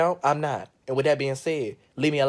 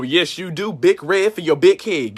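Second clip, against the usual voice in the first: ordinary talk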